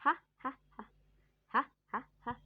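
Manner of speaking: spaced-out mock laugh in separate "ha" puffs; demonstrated laugh. Shown as a demonstration. Teacher showing students the H in laughter, deliberate mock laugh. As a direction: imitate laughing, one breathy ha at a time. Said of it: "when you laughing, what you do".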